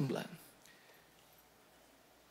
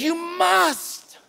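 high, impassioned; great passion; 8 semitones higher than usual. Acted. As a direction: plead it with fire. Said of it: "he said it with great passion".

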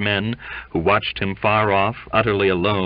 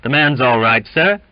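loud, raised appeal; insistent call from the crowd; protesting; 5 semitones higher, 7 dB louder than usual. A narrator's reading. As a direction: appeal to the officer, lifting at the end. Crewman protests from the shadows.

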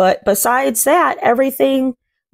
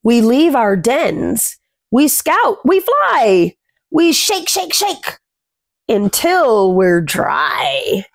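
gentle rhyming picture-book read, words savoured; reading to children; slower than usual. A narrator's reading. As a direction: read the rhyme aloud to little ones. Reads rhyming picture book aloud.

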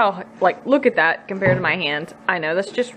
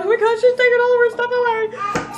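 very high, urgent squeaky pleading; frantic body voice; 13 semitones higher than usual. Pretend. Mom voicing her craving body, frantic and squeaky high. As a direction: beg for junk food like a panicking gremlin. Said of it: "My body's, like, freaking out".

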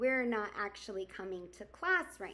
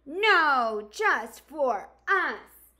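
loud; 5 semitones higher, 11 dB louder, much slower than usual. Acted. Animals refuse the newcomer.

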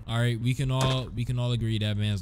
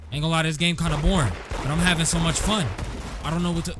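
ordinary delivery

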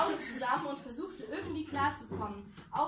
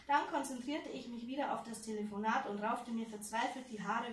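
ordinary delivery, nothing marked

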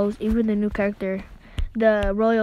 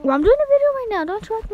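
much higher exasperated retort; annoyed snap; 10 semitones higher, faster than usual. Real, not acted. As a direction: snap at the little sister. Scolding interrupting sister.

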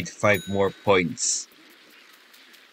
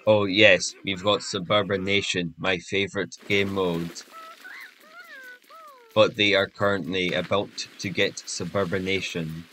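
monotone voice; 2 semitones higher, 6 dB quieter than usual. Pretend. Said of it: "saying stuff in a monotone voice".

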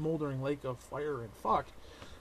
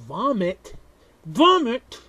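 disgusted yell; 5 semitones higher, 13 dB louder, much slower than usual. Acted. Yelled disgust.

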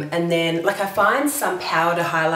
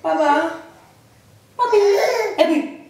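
high, laughing little calls; 11 semitones higher, 4 dB louder, much slower than usual. Real, not acted. Playing with toddler.

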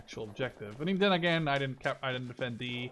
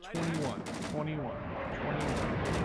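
counting seconds; much slower than usual. Shown as a demonstration. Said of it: "let's fire a round. Let's see".

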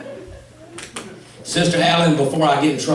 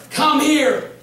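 lightly voiced sweet call, higher; "Come here." sung out higher, sweet; calling him back; 8 semitones higher than usual. Wife calls him back from the truck, higher sing-song call.

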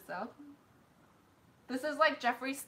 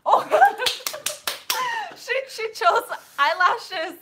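laughing surprise; 12 semitones higher, 10 dB louder than usual. Real, not acted.

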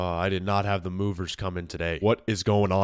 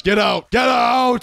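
furious yell, getting louder and higher; angry shouting; 14 semitones higher, 7 dB louder, slower than usual. Acted. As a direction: throw them out of your house. Owner chasing intruders out.

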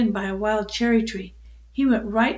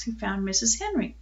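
usual speaking voice

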